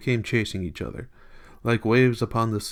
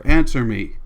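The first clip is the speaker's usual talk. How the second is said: loud, hard command; explosive order; shouting; 8 dB louder than usual. A narrator's reading. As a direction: shout the demand forcefully. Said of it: "shouted again at her".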